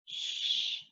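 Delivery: soft hissed sh, no voice; sh sound. Shown as a demonstration. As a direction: air through the lips, sh. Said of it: "it spells the sound".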